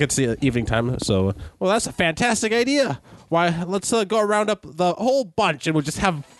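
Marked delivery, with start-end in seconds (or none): 1.6-6.3 put-on high, cheerful old lady; delighted old woman inviting guests; elderly woman voice. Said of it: "my elderly voice isn't very good".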